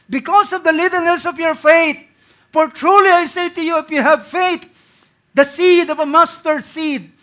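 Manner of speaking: loud, high, slow, ending in a shout; preacher crying out the mustard seed promise; preaching at full force. Acted. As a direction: build to a shouted climax. Preaching climax.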